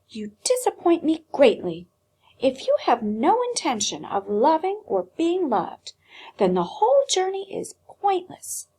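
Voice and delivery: put-on a stern old doll growing fierce; stern scolding. A narrator's reading. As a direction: berate him, then bark the last lines. Doll scolds Edward harshly.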